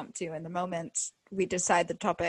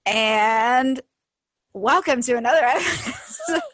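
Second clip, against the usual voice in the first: loud drawn-out "And", a gap, then higher "welcome to another episode"; mock dramatic pause; 3 semitones higher, 7 dB louder, slower than usual. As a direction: imitate a co-host stalling grandly after "And". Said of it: "I like to pause for dramatic effect".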